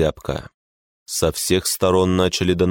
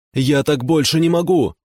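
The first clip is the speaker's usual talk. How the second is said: high desperate cry; shouting; 9 semitones higher, 3 dB louder than usual. A narrator's reading. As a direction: cry out at the end of his rope. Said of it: "закричал Жора".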